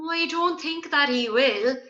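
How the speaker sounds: mildly sad doubt; sadly. A narrator's reading. Gigi doubting Oren will help, mildly sad. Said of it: "said Gigi sadly".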